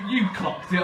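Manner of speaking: ordinary delivery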